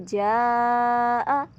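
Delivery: जा held long, vowel stretched four counts. Shown as a demonstration. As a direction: draw out the आ in जा. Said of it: "चार अलिफ के बराबर खींचकर लंबा करके पढ़ेंगे". (जा को लंबा खींचकर)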